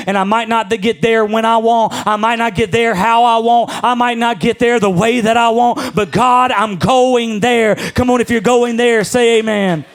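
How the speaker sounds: rising call for the congregation to answer; preaching at full force. Acted. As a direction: preach it up to the amen.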